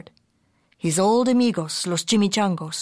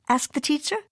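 ordinary delivery, nothing marked

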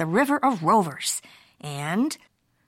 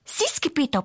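higher little voice, lightly panted; panting; 6 semitones higher than usual. A narrator's reading. Smallest chihuahua answers Skipito, lightly panting. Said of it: "panted Poquito Tito".

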